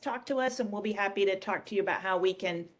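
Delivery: ordinary delivery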